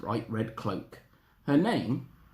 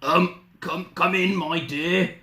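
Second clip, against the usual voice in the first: loud, scratchy fake Granny; scratchy wolf voice; 6 semitones higher, 4 dB louder, slower than usual. Disguised wolf inviting Little Red in, scratchy voice. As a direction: rasp it as a wolf posing as Granny. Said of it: "deep, scratchy voice that answered".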